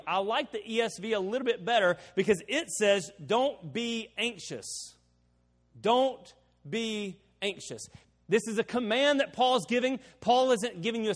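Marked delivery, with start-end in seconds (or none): 3.3-4.9 "Don't be anxious" in slow, separated words; slow, deliberate weight on "be"; emphatic BE. Shown as a demonstration. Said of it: "I emphasize the word be". 5.8-7.9 "Don't... be... anxious", word by word with pauses; very slow, deliberate "be"; emphatic BE. Shown as a demonstration. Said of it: "I emphasize the word be".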